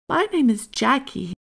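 high, lightly voiced introduction; polite girl. A narrator's reading. Jackie introducing friends to the hare, polite.

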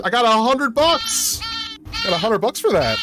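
excited. Real, not acted. Paid after first shift.

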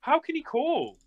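high, stunned outrage; outraged disbelief. Real, not acted. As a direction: squeal it in disbelief. Outrage at opponent call.

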